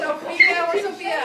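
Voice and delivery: calling a name across room. Real, not acted. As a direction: call out loudly. Teacher calling out for student.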